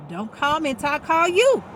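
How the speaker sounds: sassy stern telling-off; firm warning. Real, not acted. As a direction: set the boundary with a bite. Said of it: "telling family off".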